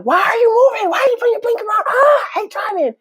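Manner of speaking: high mock yell at other drivers; mock road rage. Telling cohost how she yells at other drivers, high mock road rage.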